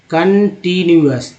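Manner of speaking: कंटिन्यूअस slowly, part by part; broken into parts. Shown as a demonstration. Teacher showing learners the parts of word, कंटिन्यूअस broken up slowly. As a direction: split कंटिन्यूअस into slow, separate chunks. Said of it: "तोड़ तोड़ के कैसे पढ़ेंगे".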